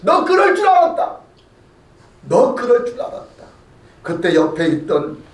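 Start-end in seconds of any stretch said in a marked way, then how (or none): 0.0-3.4 first line pitched higher, a taunting 너 그럴 줄 알았다; a man sneering at the fleeing king; mocking jeer. Shimei taunts the fleeing David, mildly mocking. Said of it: "조롱을 하는 겁니다".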